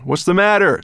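high, loud called question; calling back. A narrator's reading. Dragon asking his quarrelling riders, calling back. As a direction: call it back over your shoulder. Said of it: "the dragon called back to them".

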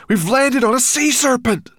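high, loud panicked shout; alarmed cry. A narrator's reading. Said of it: "cried Ben".

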